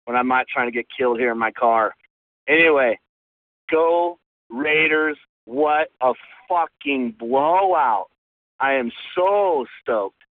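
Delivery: ordinary talk, nothing marked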